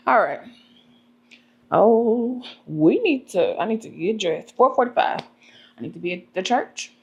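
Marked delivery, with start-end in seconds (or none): none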